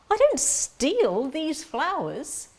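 loud, high and slow protest; emphatic denial. Acted. Accused social worker to the sir, emphatic denial. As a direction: ring it out slowly, every word insisted on.

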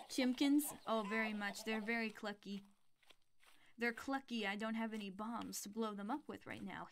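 ordinary talk

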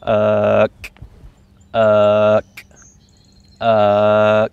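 "uh" held evenly, each cut off by a K; back-of-tongue K. Shown as a demonstration. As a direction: drone "uh" and stop it with the back of the tongue. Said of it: "notice the back of the tongue".

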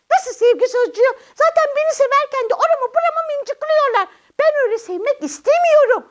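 high, protesting little character voice; squeaky-high puppet protest; indignant puppet child. Acted. Puppet protests being pinched.

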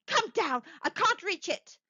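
high, urgent call for help; strained cry. A narrator's reading. Wife calling husband down to the bolt, strained cry. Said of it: "strained and panting".

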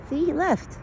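high, sweet voice calming a scared deer; soothing pet talk. Acted. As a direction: coo to the frightened deer. Reassuring the deer.

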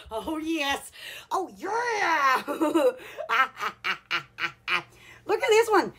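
usual speaking voice

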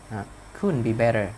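ordinary talk

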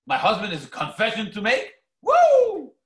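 eager question breaking into a high, loud whoop; wife thrilled her husband will confess; excited wife. Retold or imitated. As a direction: squeal with delight at the news. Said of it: "she'd be, like, all excited".

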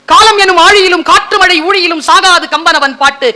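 lifted, ringing delivery of the verse; rousing verse recital. Shown as a demonstration. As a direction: recite it with heightened relish. Repeats verse with more relish.

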